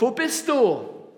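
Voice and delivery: high, loud, drawn-out call. Acted. Preacher voices God searching through the country, calling out. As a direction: call out across a whole land. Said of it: "ist in unserem Land unterwegs und ruft".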